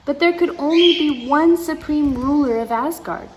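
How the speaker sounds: usual speaking voice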